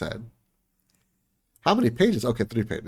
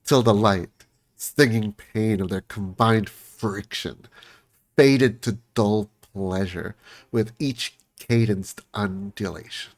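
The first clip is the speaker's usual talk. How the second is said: ordinary talk, nothing marked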